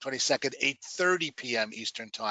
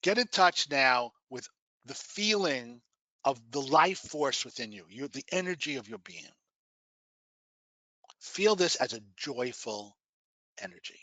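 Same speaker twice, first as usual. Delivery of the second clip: guided meditation. Acted. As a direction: guide them gently into relaxation.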